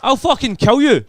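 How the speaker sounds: very high, loud furious scream; shrieked, hammed-up fury; lost temper. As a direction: scream in a comic rage. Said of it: "I lost my temper".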